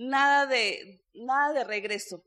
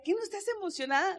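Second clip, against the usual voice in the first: higher, quick imitation of an annoyed husband; mock irritated question, pitched up; 6 semitones higher, faster than usual. Husband challenging his calm wife, imitated annoyance. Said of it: "se enojaba y decía".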